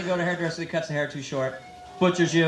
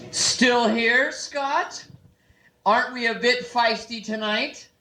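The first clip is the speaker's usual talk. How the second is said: higher-pitched, hammy mock challenge; cheap theatrical gag; 4 semitones higher, much slower than usual. Comic teasing the emcee who introduced him, theatrical. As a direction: ham up the teasing questions. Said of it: "with a cheap theatrical gag".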